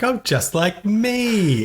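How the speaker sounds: slow, high imitation of her New York accent. Imitating Marla flirting with Billy, high slow New York accent. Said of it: "in that weird accent".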